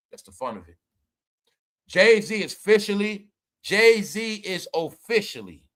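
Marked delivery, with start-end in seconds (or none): 3.6-5.6 slow, drawn-out announcement; slow dramatic. Real, not acted. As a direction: stretch each word for drama. Restarted announcement, slow dramatic take.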